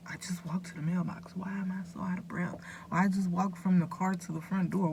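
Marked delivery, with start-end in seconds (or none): 0.1-2.6 whispered to herself, baffled; hushed, wondering whisper; puzzled whisper. Retold or imitated. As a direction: breathe the question out like a private worry. Retelling her own puzzled thoughts.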